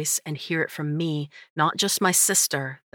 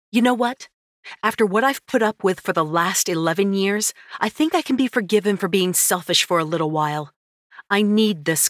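higher, louder heated retort; angry retort; 4 semitones higher, 3 dB louder than usual. Acted. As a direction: fire back at the brother. Heroine snapping back.

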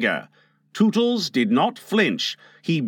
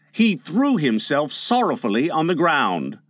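ordinary delivery, nothing marked

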